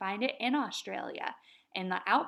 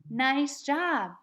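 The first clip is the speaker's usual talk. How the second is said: praise; 5 semitones higher, 5 dB louder than usual. Real, not acted.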